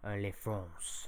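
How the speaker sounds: slow, soft French les France; French pronunciation. Pretend. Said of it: "that was actual French".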